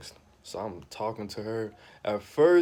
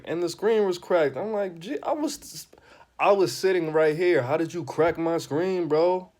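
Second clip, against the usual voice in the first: higher and louder, outraged at a cracked screen; mock outrage; 8 semitones higher, 8 dB louder than usual. Comedian to the host, outraged over the cracked screen. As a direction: blow up over the broken phone.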